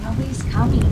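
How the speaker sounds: a loud, groaning complaint. Acted. Hop groans to Hip that no trick-or-treaters came, loud and miserable. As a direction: groan it out in despair. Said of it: "groaned Hop".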